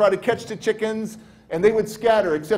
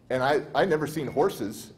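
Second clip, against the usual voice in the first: ordinary delivery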